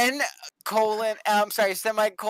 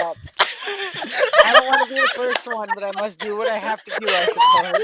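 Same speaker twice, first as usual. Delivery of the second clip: fast, high, giggling reluctance; nervous laughing; 12 semitones higher, 4 dB louder, much faster than usual. Real, not acted. Nervous laughing before reading.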